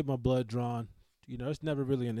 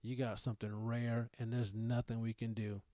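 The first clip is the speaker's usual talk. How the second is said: grim doctor; 3 semitones lower, 6 dB quieter than usual. Retold or imitated. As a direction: deliver the death sentence flatly. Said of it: "doctor just drops the fucking hammer".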